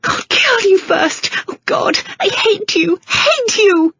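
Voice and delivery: high, slow hysterical shriek. A narrator's reading. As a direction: shriek it in panic, fighting free. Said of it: "she shrieked, panting furiously".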